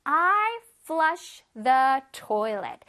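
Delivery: very slow, word-by-word teacher's model; slow modelling. Shown as a demonstration. Teacher reads an example for children, very slowly. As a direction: stretch each word out clearly for learners.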